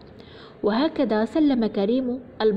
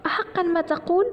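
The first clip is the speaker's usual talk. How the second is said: high-pitched hopeful question, rising at the end; delighted mother; 8 semitones higher than usual. A narrator's reading. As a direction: ask in happy disbelief. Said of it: "قالت الأم فرحة".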